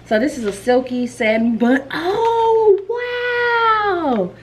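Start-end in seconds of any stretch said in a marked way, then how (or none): 1.9-4.3 much higher pitched gasp of wonder; high surprised gasp. Real, not acted. Vlogger seeing a satin bun, high surprised gasp. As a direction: let "wow" float up high in surprise. Unboxing gifts.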